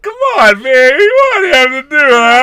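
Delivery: high, loud crying whine; mock whiner. Mocking real whining about movies, high crying shout. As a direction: wail like a sore loser. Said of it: "it's not like".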